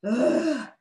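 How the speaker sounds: high puffed-out "ugh"; exhausted groan. A narrator's reading. Pig bent over after running, exhausted groan. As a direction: groan out a spent breath. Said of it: "took gulps of air".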